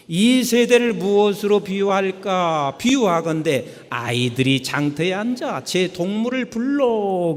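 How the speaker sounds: leading the congregation in scripture; scripture reading. Shown as a demonstration. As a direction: read the verse aloud together. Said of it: "시작".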